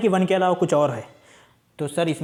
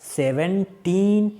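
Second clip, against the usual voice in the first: drawn-out teaching; much slower than usual. Shown as a demonstration. Teacher models number reading.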